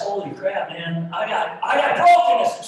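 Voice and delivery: rising to a shouted cry on brokenness; sudden realization. Retold or imitated. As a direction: blurt it out as the truth hits. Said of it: "and they realize".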